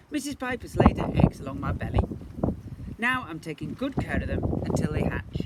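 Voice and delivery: lightly voiced proud fish; proud Mister Pipe. Mister Pipe tells the seahorse proudly, loud and quick.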